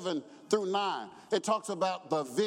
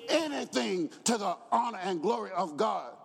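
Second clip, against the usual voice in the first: thundering preacher pronouncing the sentence; preaching; 4 semitones higher than usual. Acted. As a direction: hammer the judgment home. Preaching the verdict.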